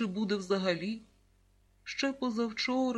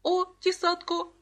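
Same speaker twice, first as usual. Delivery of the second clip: put-on higher, louder little child's exclamation; excited toddler; 9 semitones higher, 5 dB louder than usual. A narrator's reading. A toddler answers about the secret key, excited. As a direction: pipe up eagerly as a toddler.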